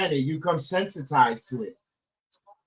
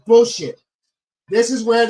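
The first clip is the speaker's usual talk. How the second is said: angry; 6 semitones higher, 8 dB louder than usual. Real, not acted. Fan furious at false finish.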